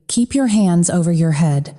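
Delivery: usual speaking voice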